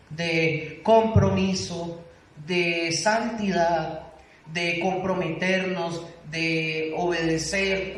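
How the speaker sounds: each item slow and weighted; slow emphatic list. Real, not acted. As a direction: lay out each word slowly, like a list of charges. Slow list of sermon topics. (enumeración lenta y enfática)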